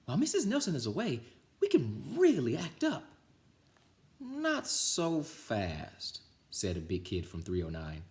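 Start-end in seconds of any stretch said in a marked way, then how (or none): 0.0-3.0 put-on high, fast kid voice plotting; high gleeful kid voice; excited kids. 4.2-6.2 slow, drawn-out warning; higher slow warning; big kid warning. Acted.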